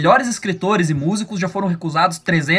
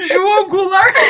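high giggling through the name; laughing; slower than usual. Real, not acted. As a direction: laugh it out, amused. Laughing at forgotten president name.

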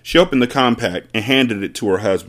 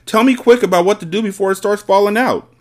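high, urgent plea; frantic father, voice raised high; wailing; 12 semitones higher, 4 dB louder than usual. A narrator's reading. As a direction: plead in panic for quick advice. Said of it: "wailed the father".

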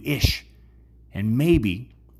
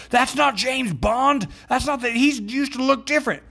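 mock yell at the screen. Moviegoer yelling at the screen, high mock shout. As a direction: yell it from a theater seat. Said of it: "We could stand up in the theaters and yell".

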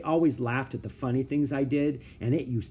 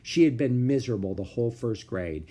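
ordinary delivery

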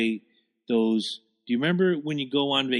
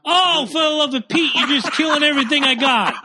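exasperated; 9 semitones higher, 4 dB louder than usual. Real, not acted. His ideas keep getting shot down.

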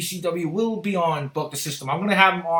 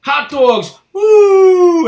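shouted hot dog call, louder and higher on the woo; vendor shout; 11 semitones higher, 11 dB louder, much slower than usual. Retold or imitated. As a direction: holler it like a stadium vendor. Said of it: "and I'm like".

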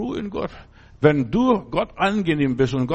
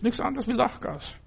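much higher and softer, playful; high, soft teasing repeat; 11 semitones higher, 5 dB quieter than usual. Shown as a demonstration. Preacher teasing his congregation about Lachgas, high and soft. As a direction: toss it off lightly, way up high.

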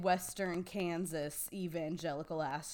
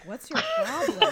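incredulous; 7 dB louder than usual. Retold or imitated. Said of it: "looking at me like".

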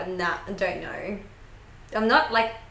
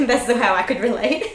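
laughing; 3 semitones higher, 7 dB louder than usual. Real, not acted. Laughing at her own joke.

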